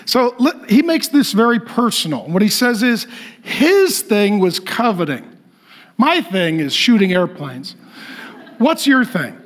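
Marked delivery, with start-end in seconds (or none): none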